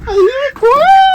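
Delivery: very high, rising playful voice; high-pitched singsong with a held "quack". Pretend. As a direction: send it way up, stretching "quack". Repeats it far higher.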